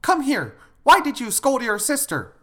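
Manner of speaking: loud, high-pitched parental scolding; telling off. Acted. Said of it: "You can't just tell off the elder sister".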